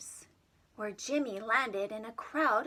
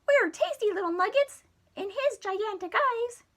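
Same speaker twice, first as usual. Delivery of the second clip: higher, louder squeaky cry; frightened Marsman; 10 semitones higher, 6 dB louder than usual. Acted. Marsman crying out in fear about the monster. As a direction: squeal it in alarm.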